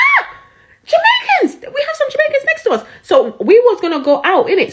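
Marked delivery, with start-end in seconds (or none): 0.1-2.9 high, thrilled exclamation; much higher excited cry to his friends; excited realisation. Retold or imitated. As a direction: squeal it out, delighted at the discovery. Realising neighbors are Jamaican.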